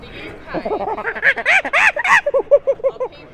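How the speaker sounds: high, loud hooting monkey call; monkey impression. Showing the officers he can speak monkey, hooting monkey call. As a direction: whoop like a monkey to show off. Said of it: "I've learned how to speak monkey".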